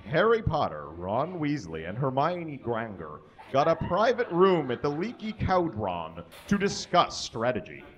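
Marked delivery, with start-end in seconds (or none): none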